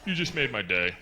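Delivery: low confiding remark in passing; passing stranger aside. Retold or imitated. Stranger passing the husband, low appreciative aside. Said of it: "a man you don't know walks past".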